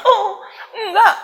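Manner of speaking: high, slow laugh into the words; amused chuckle, then a correction. Real, not acted. Laughs at thinking 44 is old.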